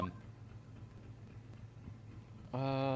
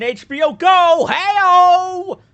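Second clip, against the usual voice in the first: excited; 15 dB louder, much slower than usual. Real, not acted. Joking at the audience about HBO, a loud triumphant whoop. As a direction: shout the punchline, much higher and louder. Punchline shouted at the camera.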